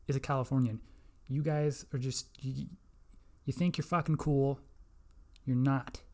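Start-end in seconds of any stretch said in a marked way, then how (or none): none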